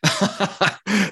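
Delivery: a short embarrassed laugh; nervous laugh. Real, not acted. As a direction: laugh it off at the bad news. Laughing at own mistake.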